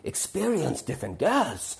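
put-on German-accented old lady, climbing in pitch; old German woman. Old woman gives life advice to young men, German accent. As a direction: imitate an elderly German woman dispensing advice. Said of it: "this German woman with a German voice".

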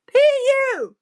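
high-pitched stink complaint; high, exaggerated revulsion; disgusted cry. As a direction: squeal it in disgust, drawn out. Sonny reacts to the smell.

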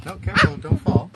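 alarmed warning. Real, not acted. Father calling out to his daughter about to fall, alarmed. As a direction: stop her from falling, urgently.

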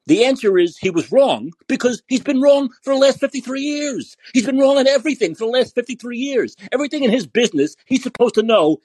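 heated, pitch up, building rant; furious at the man; real anger. Real, not acted. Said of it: "And I'm getting angry again".

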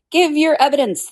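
high, firm angry demand; angrily. Acted. The king ordering the Hatter again, high angry command. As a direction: snap the order at him. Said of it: "the king repeated angrily".

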